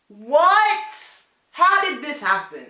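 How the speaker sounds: high, loud, astonished outburst; shocked disbelief. Retold or imitated. Hearing friend spent the day in jail, shocked. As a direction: shriek the question in mock shock.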